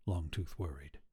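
ordinary delivery, nothing marked

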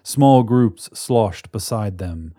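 ordinary delivery, nothing marked

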